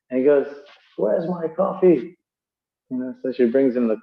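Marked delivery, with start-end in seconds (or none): 1.0-2.2 slow, high demand; hammed-up spoiled husband. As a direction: call for coffee like a lazy husband.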